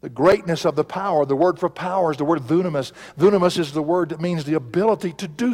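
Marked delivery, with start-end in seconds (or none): none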